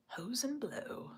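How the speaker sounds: normal talking voice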